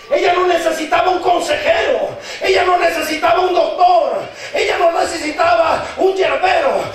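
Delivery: fervent preaching in driving parallel phrases; prédica a viva voz. Real, not acted. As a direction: build to an impassioned climax. (predicación encendida)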